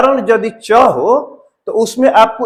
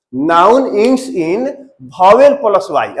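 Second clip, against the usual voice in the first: very slow, word by word for the class; slow dictation; much slower than usual. Shown as a demonstration. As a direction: spell the rule out slowly, pausing. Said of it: "teacher dictating rule slowly". (धीरे-धीरे लिखवाते हुए)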